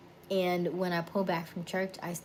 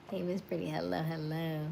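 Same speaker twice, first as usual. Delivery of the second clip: normal talking voice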